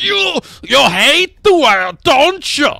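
put-on loud, high German-accented authoritarian; hammed-up German accent, high and shouted; mock German accent. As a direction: shout it like a cartoon German enforcer. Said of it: "the Irish sound German".

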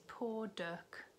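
ordinary delivery, nothing marked

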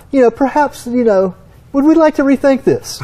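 high, delicately polite mock suggestion; mock-refined politeness. Engineer nudges the experts to reconsider, with mock-refined politeness. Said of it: "You know, my pinky up".

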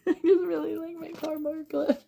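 high, laughing put-on voice. Repeats the line in two voices.

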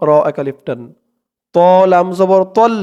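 usual speaking voice

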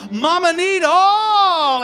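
slow, high, crying wail on Mama need all; drawn-out wail. Acted. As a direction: stretch all into a long cry. Her demand, repeated as a slow wail.

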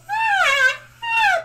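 very high, loud pleasure squeals; delighted squeals. Pretend. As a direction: squeal as if tickled by pats. Getting head pats.

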